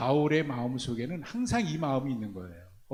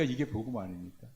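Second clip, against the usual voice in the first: "이게 복음 아닙니까" repeated much softer and lower; quiet echo; 5 semitones lower, 6 dB quieter than usual. Shown as a demonstration. Preacher repeating his question inwardly, softly. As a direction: echo it to yourself, hushed.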